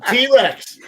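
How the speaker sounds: loud, high laughing shout at a cheap friend; laughing ribbing. Real, not acted. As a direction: yell it through real laughter, teasing. Ribbing a friend about free trip.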